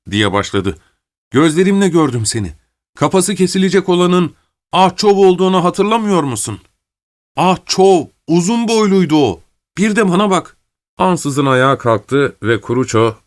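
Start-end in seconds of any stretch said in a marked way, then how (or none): 1.3-10.6 high-pitched, urgent appeal from a prisoner; pleading to be recognised; insistent prisoner. Acted. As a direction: make him remember, pressing every point.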